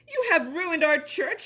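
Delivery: high reproachful shout. A narrator's reading. Brother shouting at Juniper over ruined church. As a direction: call out the blame. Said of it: "shouted Brother Bernard".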